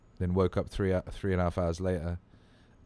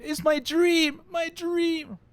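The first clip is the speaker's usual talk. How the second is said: put-on very high, loud overjoyed kid voice; imitated excited child; 8 dB louder, much slower than usual. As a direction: squeal it like a thrilled small boy. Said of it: "You're so excited".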